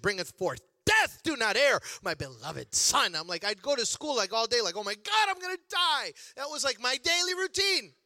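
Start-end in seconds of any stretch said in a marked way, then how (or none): 0.0-3.1 loud, imitated scripture warning; hammed-up biblical warning; mock doom preaching. Mimics mother's dire verse. 4.8-6.1 loud, hammed-up dread climbing high; comic wail of panic; mock panic. Acts out his panic at school.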